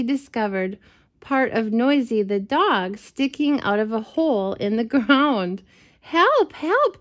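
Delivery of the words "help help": high, rising cries of "help"; crying for help. Acted. Dog stuck in a rabbit hole, crying high for help. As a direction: call out like a dog in trouble. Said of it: "cried Noisy".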